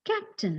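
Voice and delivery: "captain" with clean "kap", high then falling; correct vowel. Shown as a demonstration. Teacher showing students the correct pronunciation, clean vowel. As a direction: say "captain" with no y after the c. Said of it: "look at the correct pronunciation".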